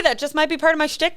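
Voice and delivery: ordinary delivery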